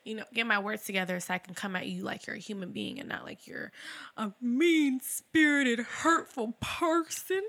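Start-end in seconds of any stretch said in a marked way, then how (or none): 4.2-7.4 slow, high-pitched mock hurt; mock pained. Admitting how they come at people when upset, mock pained. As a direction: draw it out high and wounded, in jest. Mock description of being snapped at.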